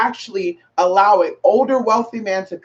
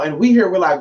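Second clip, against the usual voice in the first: ordinary talk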